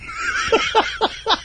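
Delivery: high breathless maniac cackle; airy unhinged giggle as a punchline; lunatic laugh. Retold or imitated. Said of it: "laugh like a lunatic".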